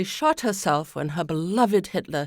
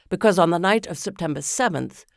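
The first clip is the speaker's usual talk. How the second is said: ordinary delivery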